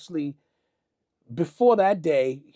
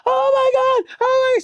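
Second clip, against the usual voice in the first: very high, loud mock shriek; imitated screaming; 7 dB louder than usual. Jennifer reacts to the studio beating, imitated scream. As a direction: shriek it as a horrified onlooker. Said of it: "she starts screaming".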